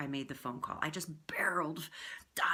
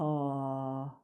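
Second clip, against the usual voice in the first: softer yawn; 4 semitones lower than usual. Shown as a demonstration. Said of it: "Try it again".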